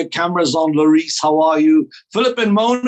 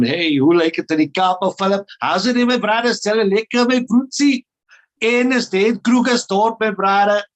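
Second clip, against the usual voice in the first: put-on playful Cape Town accent, higher; 3 semitones higher than usual. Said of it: "that's a Cape, um, Town accent".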